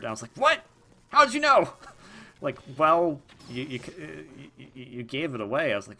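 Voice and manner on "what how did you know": high, loud mock shock; caught-out gasp of surprise; shocked squeak. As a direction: squeal it in disbelief. Retold shock at being found out.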